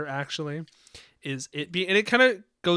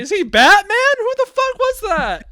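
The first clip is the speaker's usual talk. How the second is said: mock-incredulous yell, pitched way up; incredulous outburst; 8 dB louder than usual. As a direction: burst out loud and high in joking disbelief. Joking mock disbelief at story.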